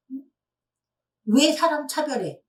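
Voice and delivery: loud, indignant challenge, lightly acted; mock angry customer. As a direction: act out an offended complaint. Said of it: "하면서 막 화를 내는". (화난 손님을 흉내 내며 따지듯이)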